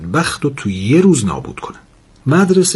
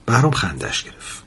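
ordinary delivery, nothing marked

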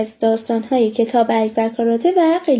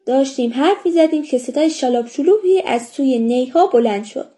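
ordinary delivery

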